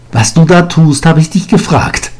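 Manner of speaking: hoch und laut, strenge alte Lehrerin; hohe durchdringende Stimme. A narrator's reading. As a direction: nachhaken wie eine keifende Alte. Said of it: "Ihre hohe, alles durchdringende Stimme". (hohe, laute Lehrerinnenstimme)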